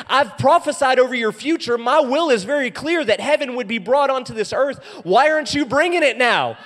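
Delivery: high, loud preaching shout; rally them at full force; rousing challenge, building to a yell; impassioned urging. Acted. Preacher voices God urging action.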